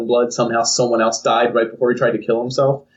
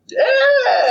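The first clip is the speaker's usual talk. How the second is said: ecstatic yes. Acted. The attractive girl agreeing at once, ecstatic squeal. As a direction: shriek your eager yes. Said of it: "And she's like".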